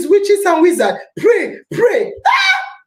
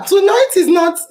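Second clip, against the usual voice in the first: ordinary delivery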